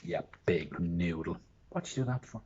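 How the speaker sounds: ordinary delivery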